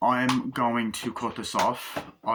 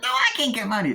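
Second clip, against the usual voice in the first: much higher voice, whiny refusal; squeaky no; actor impression; 13 semitones higher, 5 dB louder, faster than usual. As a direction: refuse like a cartoon stoner. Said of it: "doing the impressions of the, uh, actors".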